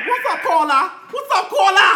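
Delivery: hey there, loud and eager; calling out. Real, not acted. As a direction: call her name out, fairly high. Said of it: "calling out to caller".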